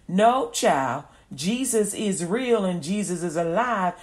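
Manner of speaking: ordinary talk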